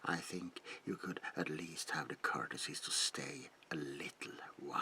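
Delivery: usual speaking voice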